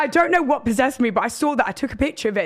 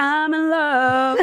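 mock smitten; 5 semitones higher, much slower than usual. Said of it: "I was like".

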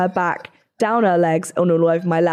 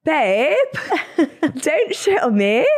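imitating Hannah; 6 semitones higher, slower than usual. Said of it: "would a hundred percent be Hannah's reaction".

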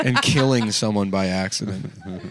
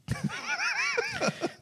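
shrieking laugh; 8 dB quieter than usual. Real, not acted. Laughing at own joke.

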